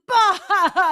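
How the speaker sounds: loud high imitated guffaw bah; imitating John Oliver's loud laugh; mock laugh burst. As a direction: bark out a laugh like him. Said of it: "he busts out laughing".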